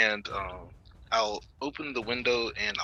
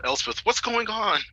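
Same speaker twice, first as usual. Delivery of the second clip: high, loud call across a battlefield; yelling; 12 semitones higher, 7 dB louder than usual. Acted. Calling from the window to Elspeth, yelled high and loud. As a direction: yell out to a distant friend, half laughing. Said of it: "I just yell".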